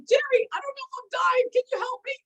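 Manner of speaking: high, tearful mock pleading; retold panic. Calling Jerry one morning thinking she's dying, high mock panic.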